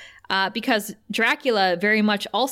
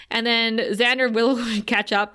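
usual speaking voice

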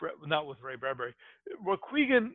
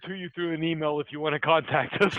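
usual speaking voice

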